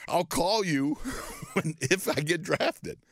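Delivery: slow, laughing through the words. Retold or imitated. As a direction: chuckle it out, easing off the plea. Laughing while pleading with grandma.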